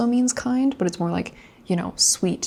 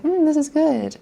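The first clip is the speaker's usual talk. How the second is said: mildly pleased taste; 8 semitones higher, 4 dB louder than usual. Retold or imitated. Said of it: "oishii is like".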